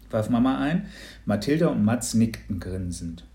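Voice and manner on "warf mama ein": usual speaking voice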